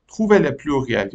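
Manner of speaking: "Trouvez le pluriel" read very slowly and clearly; slow instruction reading. Shown as a demonstration. Teacher reads the task "Trouvez le pluriel" to the class, slowly. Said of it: "teacher reads the instruction aloud".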